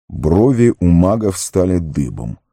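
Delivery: plain reading voice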